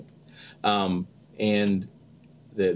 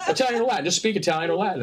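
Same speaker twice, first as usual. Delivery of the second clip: mock director; 10 semitones higher, faster than usual. Imitating director's instruction.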